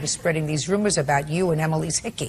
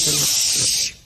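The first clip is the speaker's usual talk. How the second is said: sharp shush; 4 semitones lower than usual. Real, not acted. Judge silencing a witness mid-answer, sharp shush. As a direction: hush someone who cuts in.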